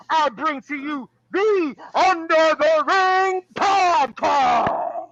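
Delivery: booming ring announcer, drawn out. Acted. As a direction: hype the crowd like a wrestling emcee. Wrestling ring announcer intro.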